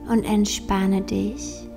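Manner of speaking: slow, soft meditation voice; guided meditation. Acted. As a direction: guide them gently into calm, slowly.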